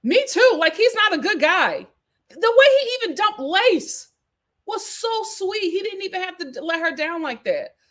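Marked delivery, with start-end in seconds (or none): 0.0-1.9 high, loud burst of agreement; emphatic agreement. Real, not acted. Vlogger agreeing with a commenter, high and loud. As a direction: jump in loudly to agree.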